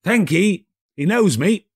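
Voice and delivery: put-on high, bright-eyed old Scrooge; eager old miser, newly kind. A narrator's reading. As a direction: play Scrooge cheerfully asking. Scrooge voiced in the story.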